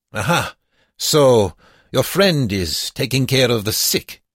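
put-on high, loud accented guard voice; gloating guard. A narrator's reading. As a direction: pounce on it like a guard catching them out. Guard discovers the two prisoners.

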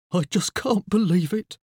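mild snuffly lament; snuffling. A narrator's reading. Father at his plant's funeral, snuffling. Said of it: "Mister McCrumpet snuffled".

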